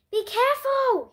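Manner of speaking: a dad shouting to his son running into the waves; shouted warning. A narrator's reading. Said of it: "he shouted".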